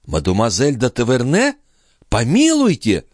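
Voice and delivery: rising, high-pitched disbelief; astonished exclamation. A narrator's reading. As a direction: echo it as a shocked question. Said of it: "воскликнула Шон".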